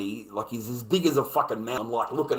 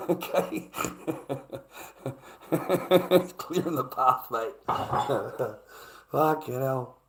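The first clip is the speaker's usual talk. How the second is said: laughing; 2 semitones higher, slower than usual. Real, not acted. Streamer amused by his own dark joke, laughing. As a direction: speak through real laughter.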